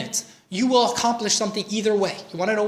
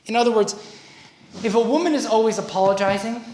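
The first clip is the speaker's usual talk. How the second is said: ordinary delivery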